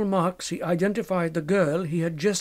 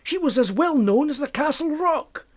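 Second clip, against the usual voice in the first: anxious insistence; 9 semitones higher than usual. Acted. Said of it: "objected Fettes".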